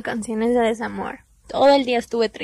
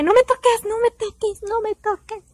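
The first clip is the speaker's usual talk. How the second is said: pleading cry; 10 semitones higher than usual. Retold or imitated. Crying, pushing him away.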